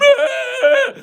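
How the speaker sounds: high-pitched imitated bawling; mock crying wail. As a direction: wail like a spanked kid. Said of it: "and he starts crying".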